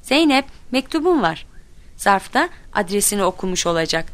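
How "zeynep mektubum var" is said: put-on mother calling to her child; higher-pitched announcement. A narrator's reading. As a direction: call the news across the house. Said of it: "annem seslendi".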